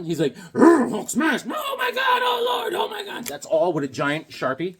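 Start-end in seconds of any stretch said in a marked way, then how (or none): 0.5-1.4 roaring, loud monster shout; hammed-up raging brute; Hulk. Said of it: "the Hulk is scaring someone". 1.4-3.2 very high mock-panicked squeal; frantic falsetto fright; scared victim. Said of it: "the Hulk is scaring someone".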